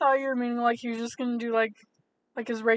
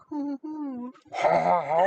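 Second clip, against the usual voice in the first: suave rose flourish; much slower than usual. Retold or imitated. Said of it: "being like".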